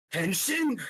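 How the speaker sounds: high, ringing hero call of Henshin; transformation shout. Acted.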